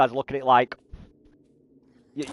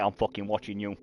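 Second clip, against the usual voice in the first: mock menace; 5 semitones lower, 9 dB quieter than usual. Dad mock-threatening boy at dance class.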